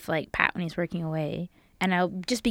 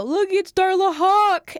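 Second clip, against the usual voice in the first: high, loud, drawn-out holler; neighbour's yell; 11 semitones higher, 8 dB louder, much slower than usual. Retold or imitated. Imitating a neighbour yelling from the window, loud high holler. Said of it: "One of my neighbors yelled from the window".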